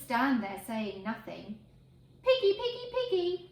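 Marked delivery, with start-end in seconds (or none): 2.2-3.5 high, loud sing-song call; calling the pigs. Shown as a demonstration. Farmer calling his pigs, high sing-song. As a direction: call out across the field to the pigs. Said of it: "will do if you don't".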